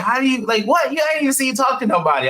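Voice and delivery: high, fast disbelieving question; the wife can't believe it; imitating the confused wife; baffled wife. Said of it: "And she was like".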